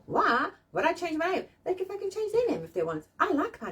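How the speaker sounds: pitched high and defiant about "Why would I change my name?"; indignant, high. Retold or imitated. Refusing to take a husband's name, high indignant protest. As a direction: raise the voice in mock outrage. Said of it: "'cause I'm like".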